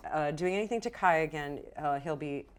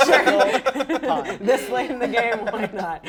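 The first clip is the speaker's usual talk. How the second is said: words through bursts of loud laughter; laughing; 5 semitones higher, 13 dB louder, slower than usual. Real, not acted. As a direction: give in, laughing. GM laughing, allowing the pun.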